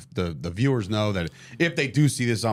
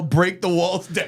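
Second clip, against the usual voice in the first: higher, drawn-out mock crowd chant; half-shouted catchphrase, slow and raised; imitated crowd yell; 7 semitones higher, 3 dB louder, slower than usual. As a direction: stretch it out like a crowd yelling along. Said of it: "did not the entire crowd yell".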